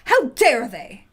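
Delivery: loud slow enraged yell; furious peasant outcry; raging. Acted. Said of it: "he raged".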